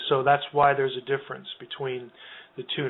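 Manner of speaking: ordinary talk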